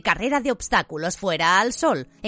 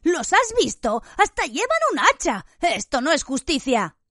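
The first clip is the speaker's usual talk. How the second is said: high, outraged protest; angry; 8 semitones higher than usual. A narrator's reading. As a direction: hiss the outrage at the axe. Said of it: "dijo enfadado Ron".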